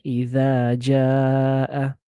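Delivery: "إذا جاء" with the final vowel held long; extended vowel. Shown as a demonstration. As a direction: draw out "جاء" to a count of four. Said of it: "we're gonna extend it".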